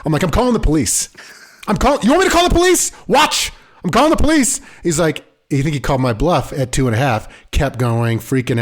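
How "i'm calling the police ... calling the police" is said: escalating threat. Retold or imitated. Dad threatening toddler at bedtime.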